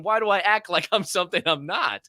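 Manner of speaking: ordinary talk, nothing marked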